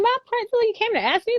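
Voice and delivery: high, incredulous question; can't believe he asked her; amazed disbelief. Retold or imitated. Teacher retelling her amazement at her principal, high and incredulous.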